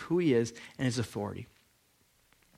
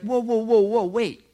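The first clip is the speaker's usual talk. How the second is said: higher, louder startled whoa, whoa; alarmed protest; 6 semitones higher, 3 dB louder than usual. Retold or imitated. Scribes objecting to Jesus, mock alarm. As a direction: jump in like shocked scribes stopping him. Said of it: "They're like".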